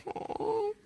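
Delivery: high, soft stunned oh; surprised. Retold or imitated. Nick stunned by the girl's kindness, soft high oh. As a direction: caught off guard, a small high oh.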